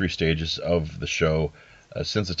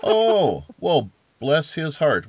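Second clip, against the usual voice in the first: pleasantly surprised; 8 semitones higher, 5 dB louder, slower than usual. Real, not acted. Hears Grant passed it along.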